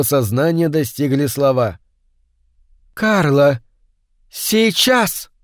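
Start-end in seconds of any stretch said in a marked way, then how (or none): 2.9-5.3 sharp rising command to attack; commanding witch. Acted. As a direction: call the dog to strike. Mrs Brown commands the dog.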